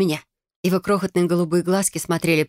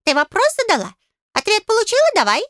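high, insistent little voice; pushy, piping fairy; demanding fairy; 4 dB louder than usual. A narrator's reading. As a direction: demand your due in a squeak.

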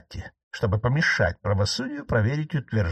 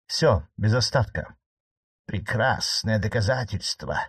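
ordinary delivery

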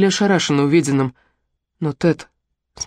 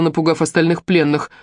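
usual speaking voice

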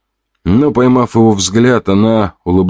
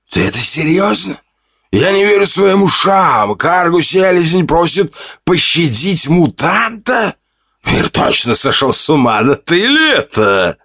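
намного выше, насмешливо изумлённо; злодей не верит своим ушам, высоко; удивление; 11 semitones higher than usual. A narrator's reading. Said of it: "удивлённо вытянулось". (главарь поражён просьбой пленника, визгливо удивлён)

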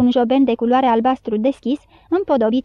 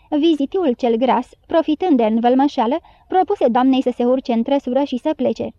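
ordinary delivery

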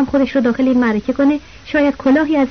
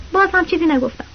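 usual speaking voice

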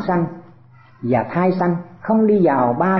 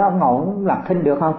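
normal talking voice

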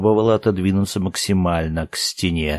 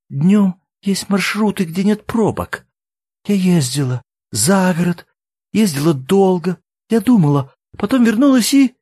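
high, halting confession; distressed; 10 semitones higher, 4 dB louder than usual. A narrator's reading. Woman explains her drive before returning, distressed. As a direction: explain it pleadingly, rising at the end.

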